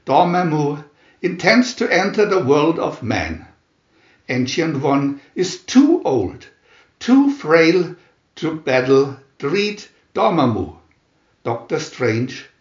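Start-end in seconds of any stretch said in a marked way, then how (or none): none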